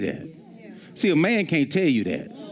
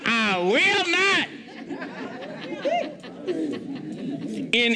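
high, slow, every word hammered; slow, soaring emphasis on each "not"; emphatic high preaching; 7 semitones higher, much slower than usual. Acted. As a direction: drive the promise home at the top of the voice. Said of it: "putting the emphasis like it really is".

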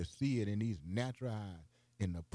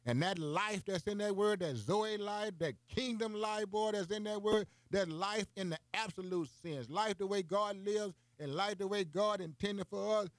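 higher, driving sermon voice; full-force preaching; 8 semitones higher than usual. Real, not acted. Broadcast preacher to listeners, full-force preaching. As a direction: preach it high and hard.

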